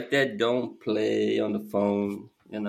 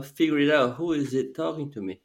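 usual speaking voice

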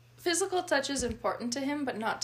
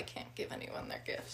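whispering; 13 dB quieter than usual. Real, not acted. Confiding aside.

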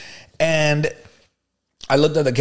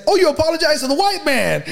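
much higher, hammed-up exclamation; high-pitched indignant outburst, retold; outraged retort; 13 semitones higher than usual. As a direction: call her out in mock outrage. Retells his outraged retort to her.

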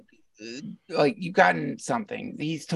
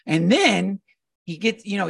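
normal talking voice

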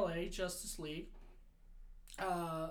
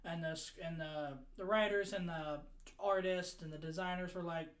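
normal talking voice

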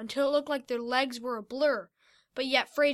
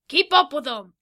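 loud urging shout in a put-on voice; yelled; 2 semitones higher, 11 dB louder than usual. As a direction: drive the giant on, shouting. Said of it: "Fido yelled".

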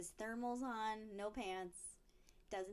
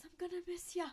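high-pitched, lilting mock farewell; teasing high-voiced goodbye at a grave; mock farewell to heaven; 6 semitones higher, faster than usual. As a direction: call it up to heaven, higher. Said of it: "she looks up into heaven".

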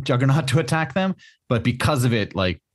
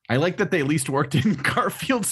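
higher, slow, breaking into laughter; laughing quote; 6 semitones higher, slower than usual. Retold or imitated. Podcaster reads out someone's comment, laughing helplessly. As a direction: struggle to get the quote out through giggles. Amused reading of a funny comment.

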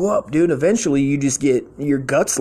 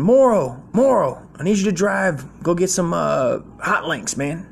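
put-on old Marine boss sending him for hot links; gruff boss voice; 5 semitones higher than usual. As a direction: bark the errand like a crusty boss.

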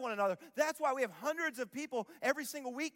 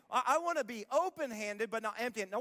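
ordinary talk

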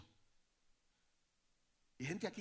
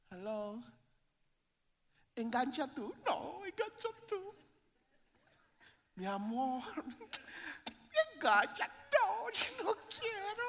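put-on higher, sugary young lovers on the phone; mock lovey-dovey couple; 13 semitones higher, 4 dB louder than usual. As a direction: tease the audience by playing shy sweethearts. Couple's sweet phone talk mimicked.

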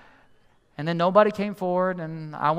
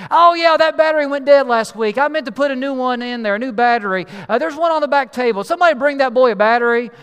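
loud, higher holler across a room; imitated congregant calling out; yelling from the pews; 6 semitones higher, 8 dB louder than usual. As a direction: holler it casually to the whole room. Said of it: "somebody else yells out from another pew".

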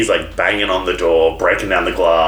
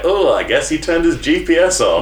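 higher, feigned innocence; mock innocent; 6 semitones higher than usual. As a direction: play dumb, lightly. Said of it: "It's like".

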